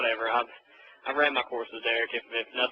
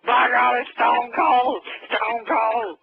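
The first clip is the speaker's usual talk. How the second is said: loud, high, drawn-out ringside hollering; hammed-up wrestling call; wrestling commentator impression; 10 semitones higher, 7 dB louder, much slower than usual. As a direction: announce a shock entrance at full cry.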